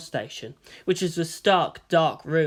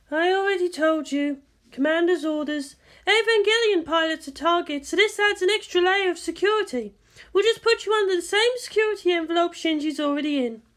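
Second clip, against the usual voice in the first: higher woman's voice, patient; guardian explaining; 13 semitones higher than usual. A narrator's reading. Misato explains orders to Asuka, higher and firm. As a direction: lay out the orders firmly.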